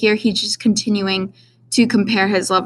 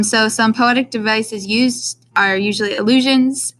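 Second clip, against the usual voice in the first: normal talking voice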